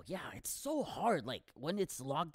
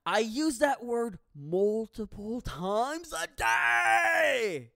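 loud, slow, rising to a howl; appalled shouting; 5 semitones higher, 6 dB louder, slower than usual. Acted. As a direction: drag it out into a yell. Appalled confession, yelled.

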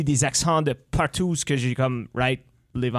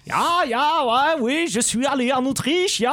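much higher, hammed-up Austrian accent; a cartoonish Austrian tourist, loud and high; 10 semitones higher, 3 dB louder than usual.

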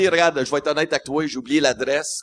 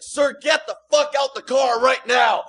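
higher, slow shouted command; mock gun-drawn order; 8 semitones higher, slower than usual. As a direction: spell out each word at gunpoint. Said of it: "He's like".

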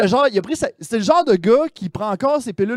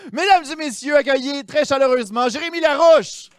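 announcer intro; 6 semitones higher than usual. Acted. Host introduces guest.

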